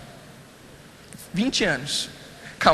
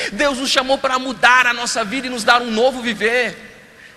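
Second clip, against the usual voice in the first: forceful sermon voice, a bit louder; preaching; 5 semitones higher, 6 dB louder than usual. Real, not acted. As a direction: preach it out to the congregation. Pastor preaching to congregation. (pregação enfática)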